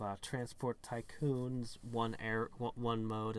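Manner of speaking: normal talking voice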